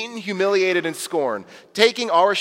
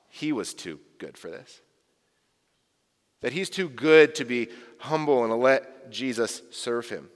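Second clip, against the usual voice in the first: ordinary talk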